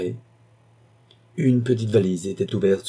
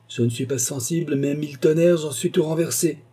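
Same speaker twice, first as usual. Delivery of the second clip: higher-pitched, agitated exclamation; shaken outburst; 7 semitones higher than usual. A narrator's reading. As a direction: blurt it out, quite overcome. Gruff man admits being shaken.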